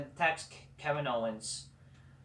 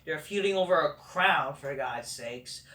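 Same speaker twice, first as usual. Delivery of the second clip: usual speaking voice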